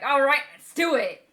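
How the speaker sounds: pumped up. Retold or imitated. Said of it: "he would have been more like".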